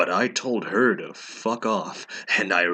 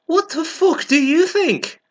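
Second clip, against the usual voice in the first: hammed-up shrieking snap, much higher and louder; angry; 7 dB louder than usual. Ebony snaps at Bloody Mary, hammed-up furious shriek. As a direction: screech it like an outraged teen. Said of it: "I asked angrily".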